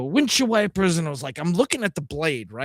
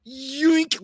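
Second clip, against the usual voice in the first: higher playful yoink; yoink sound effect; 10 semitones higher than usual. Retold or imitated. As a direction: make a quick cartoon yoink. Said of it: "I see them go".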